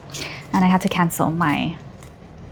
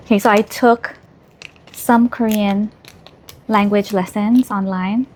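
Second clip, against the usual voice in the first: normal talking voice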